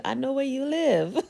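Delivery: chuckling through a playful threat; laughing. Pretend. Teasing the viewers with a laugh.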